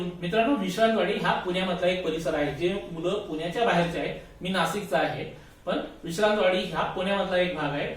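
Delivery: plain reading voice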